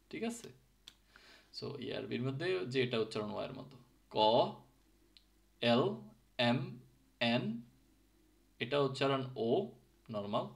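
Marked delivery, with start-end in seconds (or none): 5.6-7.6 এল, এম, এন said very slowly and distinctly; teacher modelling the letters; Finnish letter names. Shown as a demonstration. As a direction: draw out each letter. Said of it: "So just listen, carefully".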